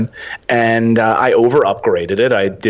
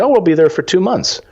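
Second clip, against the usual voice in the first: breezy answer; 11 semitones higher than usual. Retold or imitated. Couple's answer voiced.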